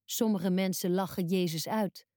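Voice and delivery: ordinary delivery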